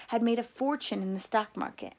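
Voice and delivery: ordinary delivery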